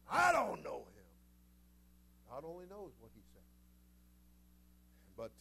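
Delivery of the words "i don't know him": slow, loud furious shout of denial; cursing denial. Acted. Peter, cornered by the crowd, shouts a furious denial. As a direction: bellow it like a cornered man swearing he's a stranger. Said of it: "He began to curse".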